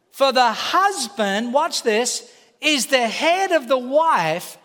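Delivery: slow, weighty, pressing on head; emphatic reading. Shown as a demonstration. Preacher reading scripture slowly to the congregation, emphatic. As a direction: lean into the verse word by word.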